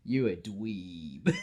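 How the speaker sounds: slow, drawn-out playful jab; slow mock insult to a co-host; teasing. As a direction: rib your friend, slowly. Teasing co-host about clothes.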